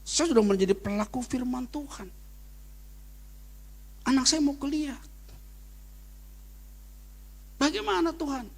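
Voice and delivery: mild pleading prayer, rising high; a touch of a tearful plea to God. Retold or imitated. Pastor retelling his prayer to God, mildly pleading and high. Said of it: "saya nangis depan Tuhan".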